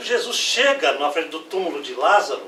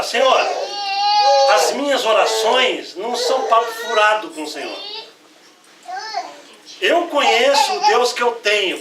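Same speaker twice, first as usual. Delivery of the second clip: loud, higher declaration; proclaiming prayer; 5 semitones higher, 4 dB louder than usual. Acted. Jesus declares before the crowd at the tomb, loud and high. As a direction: proclaim it so all the crowd hears. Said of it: "pra todo mundo escutar".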